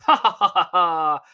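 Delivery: high, loud burst of laughter; laughing. Real, not acted. Narrator cracking up at the closing rhyme, high and loud.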